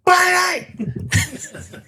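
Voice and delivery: high, laughing shout of badass; signature badass shout. Shown as a demonstration. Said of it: "you gotta say the badass".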